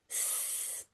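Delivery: hissing tap sound. Acted. Imitating the running tap.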